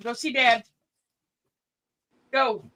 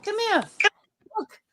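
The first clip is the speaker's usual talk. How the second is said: high coaxing call; dog-calling voice; 10 semitones higher, 3 dB louder than usual. Real, not acted. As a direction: coax the pet up high.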